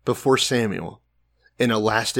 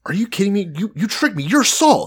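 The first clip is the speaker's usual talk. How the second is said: indignant medium; 6 semitones higher, 4 dB louder than usual. Retold or imitated. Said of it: "And she goes".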